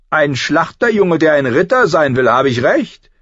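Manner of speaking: high, loud scornful questioning; mocking prince. A narrator's reading. Prince to the butcher's boy wanting knighthood, mocking. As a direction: taunt him as a haughty young prince.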